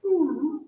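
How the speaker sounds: high-pitched dog whine; imitated dog whine. Imitating the dog whining at door.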